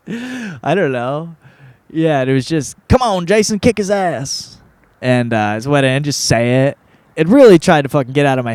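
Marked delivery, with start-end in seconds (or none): 2.9-4.6 cheering line. Retold or imitated. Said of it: "I didn't use the Southern accent".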